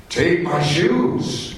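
slow, lightly acted order with a lift; commanding voice. Unseen voice pressing the tourist lady, slow and insistent. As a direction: insist calmly, drawing it out. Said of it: "deep commanding voice".

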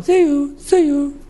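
very high, bright chirping bird cry; high-pitched bird imitation; little bird call. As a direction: squeak it out like a tiny warning bird. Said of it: "there's even a little bird in the jungle".

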